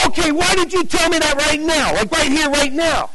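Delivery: high-pitched insistent questioning; agitated demand. Retold or imitated. Excited seeker confronts the nervous evangelist, agitated demand. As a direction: press for an answer in a high, urgent voice. Animated man presses the evangelist.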